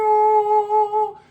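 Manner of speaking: a high, loud, put-on "oh?"; mock surprised squeal. Vlogger opening a rant with a startled squeal.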